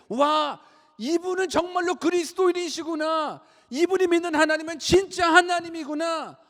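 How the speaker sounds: loud, very high cry of wonder; amazed exclamation. Acted. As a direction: exclaim like an astonished onlooker. Acts amazed unbelievers' exclamation.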